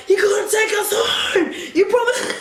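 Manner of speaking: high whiny cry, breaking into laughter; hammed-up pleading wail; mock wailing demand. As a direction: imitate a whining girl, barely keeping a straight face. Acted girls wailing at drunk driver.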